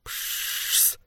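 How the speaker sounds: whispered fizzing hiss; hiss of igniting matches. A narrator's reading. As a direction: imitate matches sputtering into flame. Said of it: "Наконец раздалось". (шипение вспыхнувших спичек)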